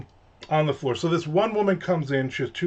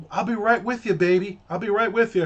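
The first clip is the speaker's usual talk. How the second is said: high-pitched mock waitress patter; waitress brush-off; 7 semitones higher than usual. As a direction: imitate a busy waitress putting customers off. Imitates supposedly busy waitress reassuring customer.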